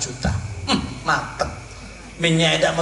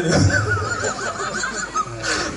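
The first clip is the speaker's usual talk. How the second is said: high laugh; 4 dB quieter than usual. Real, not acted. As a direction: burst of high-pitched laughter. High laugh after joke.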